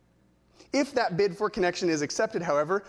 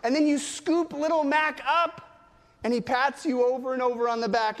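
ordinary delivery

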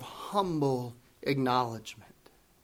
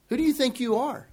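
higher, quick, scoffing challenge; mocking retort; 7 semitones higher, 4 dB quieter, faster than usual. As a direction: needle him with the question. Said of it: "we might say it this way".

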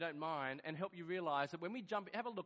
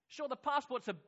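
usual speaking voice

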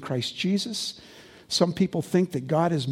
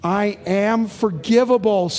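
louder and slower, leading the crowd; said out loud together; 3 semitones higher, 5 dB louder, slower than usual. Shown as a demonstration. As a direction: proclaim it slowly for all to repeat. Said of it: "Say that out loud with me".

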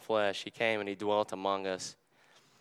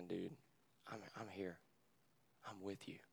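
very soft, near-whispered comfort; hushed reassurance; 3 semitones lower, 18 dB quieter than usual. Retold or imitated. Pastor voicing Jesus sitting with him, hushed tender reassurance. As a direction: say it gently and barely aloud, to console.

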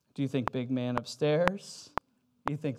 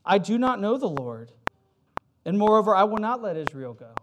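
louder, higher defiant king; defiant Pharaoh; 4 semitones higher, 6 dB louder than usual. Acted. Pharaoh defies God, scornful and loud. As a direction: throw the challenge back at God. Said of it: "Pharaoh picks a fight with God".

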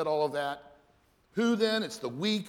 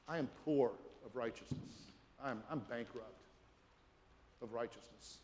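humble prayer; 7 semitones lower, 4 dB quieter than usual. Acted. Believer confessing need before the Lord, hushed humble prayer. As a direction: pray it low and broken, as if emptied out.

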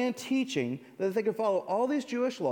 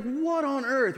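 1 furious driver; 7 semitones higher, 3 dB louder, slower than usual. Pretend. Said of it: "He's furious".

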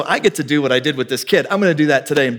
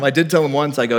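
usual speaking voice

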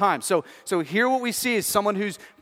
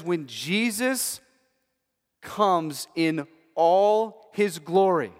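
slow emphasis; much slower than usual. Shown as a demonstration. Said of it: "Just stop there".

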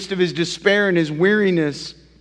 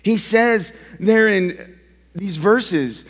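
ordinary talk

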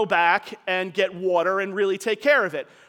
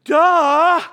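higher, louder, scoffing "Duh!"; mock audience scoff; 7 semitones higher, 10 dB louder than usual. Voicing the congregation's smug thought, loud mock scoff. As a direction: play the know-it-all listener. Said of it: "some of you are already thinking".

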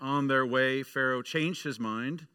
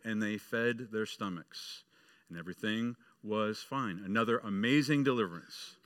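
normal talking voice

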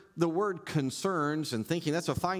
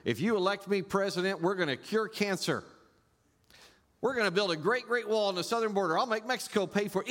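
mock politician; 7 semitones higher, faster than usual. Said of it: "but it's what they do".

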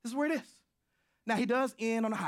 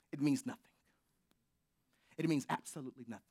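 whisper; 4 semitones lower, 3 dB quieter, much faster than usual. Real, not acted. Hushed emphasis.

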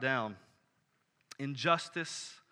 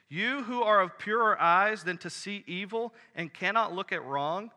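raised, louder voice crying out the verses; impassioned scripture reading; 4 semitones higher, 4 dB louder than usual. Acted. Preacher reading Habakkuk's complaint to God aloud, in a raised, impassioned reading voice. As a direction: read the prophet's complaint as a fervent plea.

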